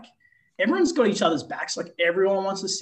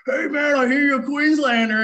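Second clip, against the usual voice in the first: higher, louder, big friendly basketball-star voice; booming Aaron Baines; 9 semitones higher, 7 dB louder than usual. Retold or imitated. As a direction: play the giant star greeting him, loud and hearty. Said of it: "he's just like".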